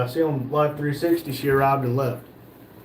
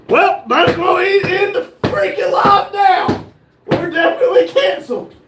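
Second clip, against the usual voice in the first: slow, high, loud exasperated rant; mock outrage; 14 semitones higher, 5 dB louder, slower than usual. Host blowing up at a mishap on the live stream, mock-furious. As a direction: ham up being fed up.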